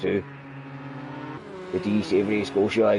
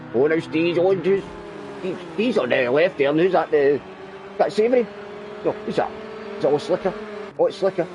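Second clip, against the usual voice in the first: excited; 6 semitones higher than usual. Real, not acted. Identifying cars in close battle.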